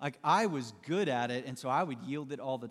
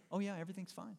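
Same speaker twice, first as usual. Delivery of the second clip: softly sulking, everything's fine; a quiet, cold brush-off; passive-aggressive; 4 semitones higher, 8 dB quieter, faster than usual. Retold or imitated. Sulking friend giving the silent treatment, quietly passive-aggressive. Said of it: "Here's what it sounds like".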